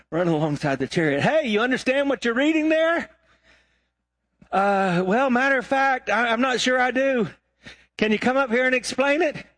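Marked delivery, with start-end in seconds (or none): 1.2-3.1 high-pitched calling voice. Retold or imitated. Philip running alongside the chariot to question its rider, calling out. 4.5-9.4 high-pitched hesitant reply; puzzled rider. Retold or imitated. Chariot rider asking Philip to explain the reading, puzzled.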